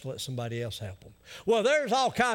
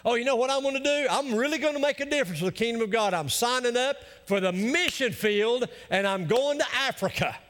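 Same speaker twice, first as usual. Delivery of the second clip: higher and fast, overeager; eager mock volunteer; 7 semitones higher than usual. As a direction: ham up an excited volunteer. Mocking eager missionary talk.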